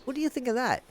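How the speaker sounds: hushed, quick drop to an undertone; quiet aside. Pretend. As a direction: tuck it in very softly. Quiet aside after sung line.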